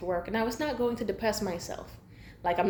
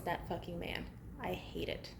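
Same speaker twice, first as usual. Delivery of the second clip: muttered aside; 3 semitones lower, 16 dB quieter than usual. Real, not acted. Muttered aside after rant.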